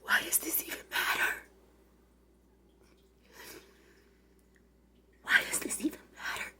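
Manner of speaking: breathy whisper, almost to herself; whispered question. Real, not acted. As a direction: whisper it in disbelief. Emotional rhetorical whisper.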